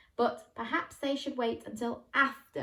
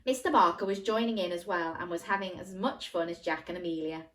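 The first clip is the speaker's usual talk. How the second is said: usual speaking voice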